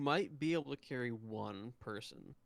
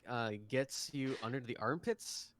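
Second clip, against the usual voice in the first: ordinary talk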